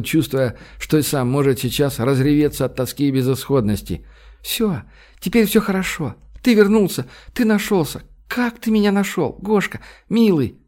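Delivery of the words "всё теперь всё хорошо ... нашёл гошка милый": high, gushing woman in love; tearfully joyful woman. A narrator's reading. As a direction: voice her high and overjoyed, clinging to him. Weeping Olga speaks to Gosh, voiced. (счастливая женщина, высоким голосом)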